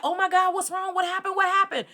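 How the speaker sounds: high-pitched alarmed questions; panicked, rushing to help; retold alarm. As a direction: caregiver startled by a patient's scream. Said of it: "And I was like".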